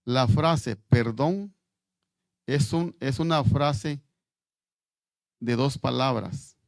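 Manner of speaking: slow, loud and deliberate, every word weighed; slow emphatic stress. Real, not acted. Preacher to his congregation, stressing the word perdón, slow and loud. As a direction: plant the phrase firmly for the congregation.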